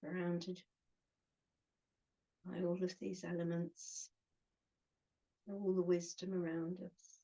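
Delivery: short phrases with long pauses between; unhurried meditation guide, giving each phrase room to settle; guided meditation. Acted. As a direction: guide them gently inward, phrase by phrase.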